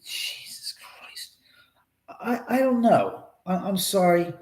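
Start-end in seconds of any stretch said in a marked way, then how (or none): 0.0-1.3 slow unvoiced whisper of dismay. Real, not acted. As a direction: breathe it out slowly in shock. Shocked reaction to fire news.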